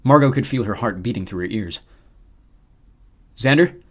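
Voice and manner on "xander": raised, hopeful call. Acted. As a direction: call a name over the line. Radio voice calling out.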